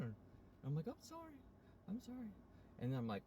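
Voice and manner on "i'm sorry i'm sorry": high, softer whimper; re-enacted pleading in falsetto; imitated meek apology. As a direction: squeak it out like someone just scolded. Retelling meek apology after being scolded.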